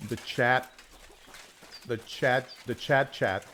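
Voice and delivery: loud sing-song call to chat. Real, not acted. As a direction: call the chat, rising each time. Calling out to chat.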